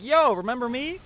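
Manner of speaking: high, loud mock call to someone. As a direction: holler it as if hailing her. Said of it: "he calls out for her".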